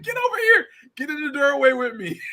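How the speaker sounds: high, loud urgent mom call; mock mom pulling her kid to safety. Mom calling her son to the doorway, high and urgent. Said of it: "She's like".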